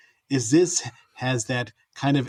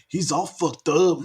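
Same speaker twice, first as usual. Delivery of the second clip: two friends riffing in mock Southern accents; 3 semitones higher, slower than usual. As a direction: ham up a Southern yokel drawl. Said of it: "that's closer".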